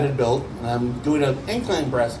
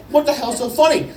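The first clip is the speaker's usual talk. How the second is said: high-pitched, baffled retelling; exasperated demand; 9 semitones higher than usual. Retold or imitated. Comic asking his laughing friend again, high and exasperated. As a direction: demand an answer over the laughter.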